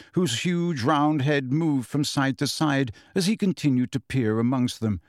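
ordinary delivery

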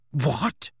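short, startled rising yelp. A narrator's reading. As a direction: blurt it in shock. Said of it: "Ron yelped".

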